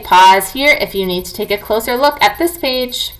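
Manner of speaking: ordinary talk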